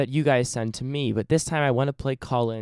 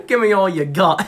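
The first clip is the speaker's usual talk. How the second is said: mock Australian accent; 4 semitones higher, 6 dB louder than usual. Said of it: "That's not Australian".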